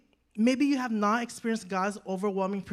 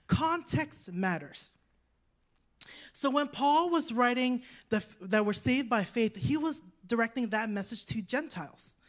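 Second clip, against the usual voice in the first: ordinary talk, nothing marked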